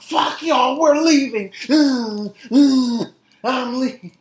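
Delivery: mock storming off.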